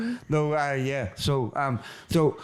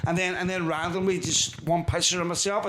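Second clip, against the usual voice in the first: normal talking voice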